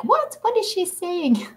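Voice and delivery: high, baffled mock viewer voice; squeaky mock bewilderment at the Dutch; confused English viewers. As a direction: play a puzzled subscriber who can't follow. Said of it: "subscribers must be like".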